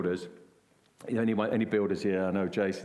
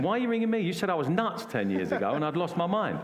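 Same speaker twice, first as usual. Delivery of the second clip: exasperated retort; 6 semitones higher than usual. Retold or imitated. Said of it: "I feel like saying to him".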